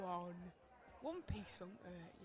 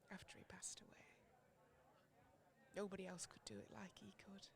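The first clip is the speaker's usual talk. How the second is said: whisper; 6 dB quieter, faster than usual. Acted. Grief over husband's death.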